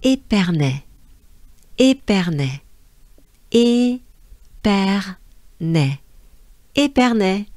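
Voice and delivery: Épernay said ay-pehr-neh with French R; French place name. Shown as a demonstration. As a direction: repeat Épernay as a drill.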